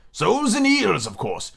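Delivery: high start dropping away, a touch impatient; rather impatient. A narrator's reading. Griffin replying to Alice, rather impatiently. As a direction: answer as if it were obvious. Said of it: "replied rather impatiently".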